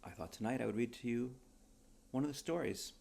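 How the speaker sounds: usual speaking voice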